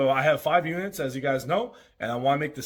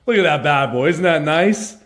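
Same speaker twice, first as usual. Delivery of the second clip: proud excitement; 7 dB louder than usual. Real, not acted. Showing off new arcade machine.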